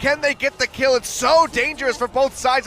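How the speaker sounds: excited. Real, not acted. Tense play-by-play call.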